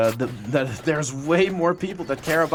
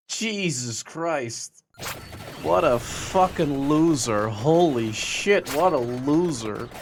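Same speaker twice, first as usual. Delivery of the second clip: scornful; slower than usual. Acted. Scornful drawl.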